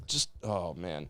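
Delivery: very soft, slow exhale of words; trailing off, spent from the rant; deflated. Real, not acted. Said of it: "Take a breather".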